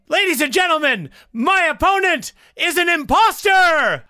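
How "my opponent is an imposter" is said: shouting. Acted. Debate accusation shouted.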